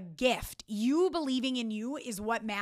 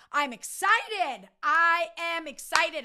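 high, slow, emphatic pep talk; excited self-talk; 5 semitones higher, 4 dB louder, slower than usual. Shown as a demonstration. Her self-talk before working on a project, high and slow. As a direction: psych yourself up out loud. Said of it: "I'm not even joking".

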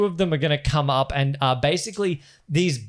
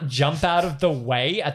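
ordinary delivery

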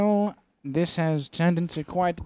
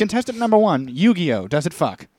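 game show host; 2 semitones higher than usual. Pretend. Announcing contestants.

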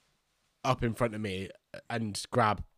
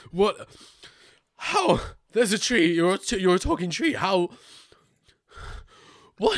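high-pitched, incredulous panic; freaking out; 7 semitones higher than usual. Acted. Adventurer faced with a talking tree, freaking out. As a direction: stammer out shocked questions, pitched way up. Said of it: "just freaking out".

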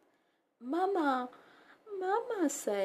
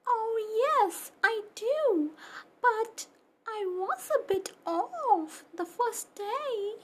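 high, bright puppy voice chatting; a little puppy telling about school; cheerful puppy; 8 semitones higher than usual. Acted. Puppy Pepper tells the host about his school, high and chirpy.

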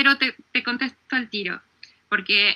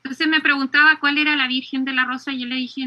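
ordinary delivery

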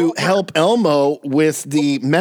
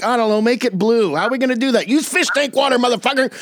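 high, pushy meth-cook rant; rough cook voice; 7 semitones higher, faster than usual. Retold or imitated. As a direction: bark orders in the lab. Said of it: "he's like".

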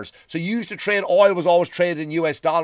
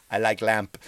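Anchorman quote; 6 semitones lower than usual. Retold or imitated. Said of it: "the guy from Anchorman".

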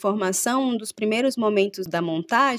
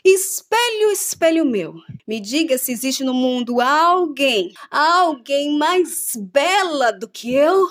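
high, grand villain queen; proud wicked stepmother asking her mirror; evil queen voice; 4 semitones higher, 4 dB louder, slower than usual. Acted.

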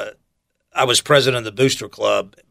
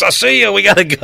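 much higher and louder, playful rush; mock hasty escape; 9 semitones higher, 5 dB louder than usual. Coworkers dodging clinic duty with a high, hurried brush-off. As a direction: bail out fast, laughing at him. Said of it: "they were like".

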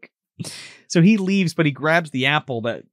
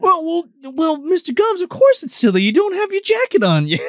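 high, sing-song put-on voice; coaxing Peter voice; 12 semitones higher, 3 dB louder than usual. Peter telling Mr Gums to put the jacket on, high coaxing. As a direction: coo it at him.

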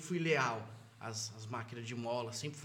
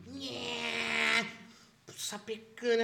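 machine buzz imitation; 8 semitones higher, much slower than usual.

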